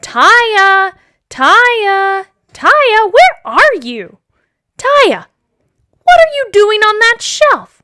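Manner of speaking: high, loud searching calls; calling out. Acted. Father looking for his daughter, calling loudly. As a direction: call out for a lost child.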